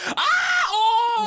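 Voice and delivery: very high, loud mock shriek of delight; piercing acted-out cackling scream; over-the-top reaction scream. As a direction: shriek like an overexcited audience member. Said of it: "rather than being like".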